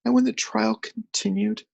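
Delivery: high, tearful; weeping whisper. A narrator's reading. Journalist weeping as he resumes. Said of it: "he said in a weeping whisper".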